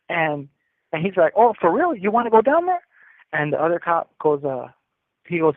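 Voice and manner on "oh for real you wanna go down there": much higher, louder mock taunt. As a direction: needle him like a smug cop. Cop taunting the arrested kid.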